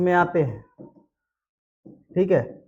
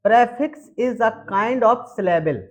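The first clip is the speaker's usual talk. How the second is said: slow dictation; 3 semitones higher, much slower than usual. Shown as a demonstration. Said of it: "dictates the definition slowly".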